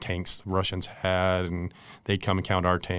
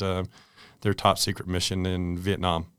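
usual speaking voice

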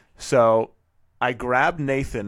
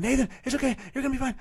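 much higher, mock freaked-out squeal; mock panicked; much faster than usual. Dad mocks a panicked version of himself to his son, high squeal. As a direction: parody the panic he didn't show. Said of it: "I didn't do any of that".

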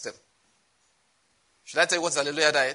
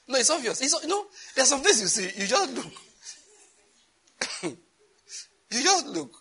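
much higher voice, words squeezed through laughter; helpless laughing; 12 semitones higher than usual. Real, not acted. Preacher telling the congregation it's obvious, laughing hard. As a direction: try to explain while cracking up. Cracking up mid-sermon at diet joke.

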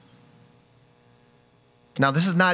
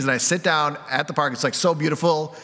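ordinary delivery

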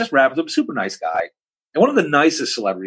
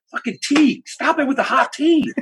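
high-pitched comic indignation; exaggerated exasperation, pitched way up; mock outrage. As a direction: protest in a high, incredulous voice. Retelling his mock outrage at tea.

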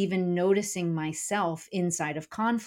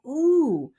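high, loud reaction; startled at an unfamiliar voice, playfully acted; raised pitch, as if noticing a stranger; mock surprise; 8 semitones higher, 6 dB louder, much slower than usual. Reacting to her changed angry voice.

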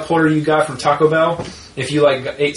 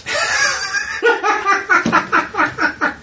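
big laugh. Real, not acted. Laughing after teasing his friend.

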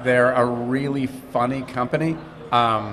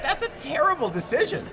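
high, softer incredulous judgment; mock dismay; 4 dB quieter than usual. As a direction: say it like a stunned actor. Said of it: "you're like".

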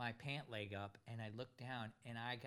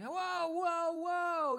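much higher and louder, a retold angry warning; mock angry whoa; 9 dB louder, much slower than usual. Pilot reenacts yelling at the biting dogs, loud and high. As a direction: bark the whoas as if the dog just bit you. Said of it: "get mad".

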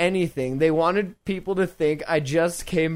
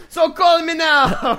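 loud, high, slow Jamaican-style pitch; psychic hotline voice; 9 semitones higher, 5 dB louder than usual. Retold or imitated. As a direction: belt it like a TV psychic ad. Miss Cleo ad voice, shouted.

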